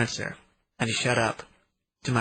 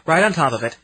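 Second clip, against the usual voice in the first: plain reading voice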